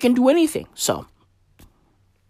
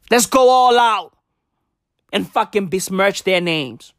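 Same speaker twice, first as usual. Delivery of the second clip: mock pharma executives; 3 semitones higher than usual. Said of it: "They're like".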